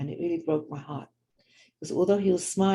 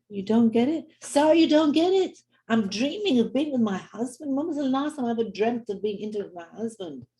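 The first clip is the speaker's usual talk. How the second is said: excited; 6 semitones higher than usual. Retold or imitated. Wife's excited revelation retold.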